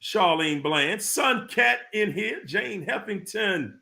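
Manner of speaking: ordinary delivery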